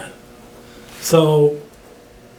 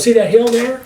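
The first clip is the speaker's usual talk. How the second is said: captain pointing; 7 semitones higher than usual. Retold or imitated. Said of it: "And he goes".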